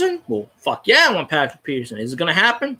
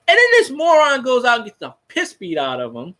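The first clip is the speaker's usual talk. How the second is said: ordinary talk, nothing marked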